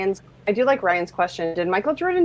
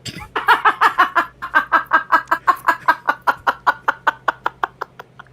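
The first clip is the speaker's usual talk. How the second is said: long, high run of laughter; 10 semitones higher than usual. Real, not acted. Friend reacting to a joke about the husband, long laugh. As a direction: laugh on and on helplessly.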